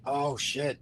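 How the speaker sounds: soft, sheepish reaction; a quiet, embarrassed realization under the breath. Retold or imitated. Wrestler retelling his slip to an interviewer, embarrassed. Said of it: "like, kind of embarrassed".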